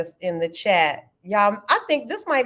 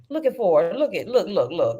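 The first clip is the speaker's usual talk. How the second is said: ordinary delivery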